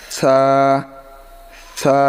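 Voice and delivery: সা as a soft lisped th, drawn out; tongue tip on upper teeth for সা; soft tha. Shown as a demonstration. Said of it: "সা, এটা নরম হবে". (জিভের আগা দাঁতে লাগিয়ে নরম সা)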